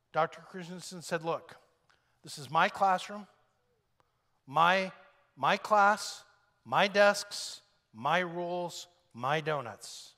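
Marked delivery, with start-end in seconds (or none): 1.2-10.1 louder, hammering each my; lightly acted stern teacher laying down the law; firm insistence. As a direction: stake out his authority word by word. Teacher insisting on his authority.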